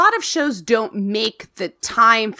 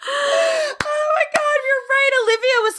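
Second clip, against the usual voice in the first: real laughter breaking into the words; laughing realization. Real, not acted. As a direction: crack up as it dawns on you. Sudden realization about Olivia.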